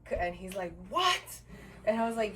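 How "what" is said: high, loud, indignant mock yell; imitating pissed-off outburst. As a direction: mimic his outraged one-word reaction.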